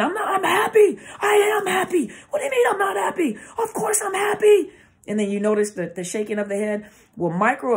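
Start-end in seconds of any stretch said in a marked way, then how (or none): 0.0-3.4 high, loud screamed insistence; demonstrated screaming. Shown as a demonstration. Speaker showing viewers words that contradict the voice, screaming. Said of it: "start screaming on the camera". 3.6-4.7 very high, loud screamed protest; demonstrated screaming. Shown as a demonstration. Speaker showing inflection that betrays the words, screaming. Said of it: "start screaming on the camera".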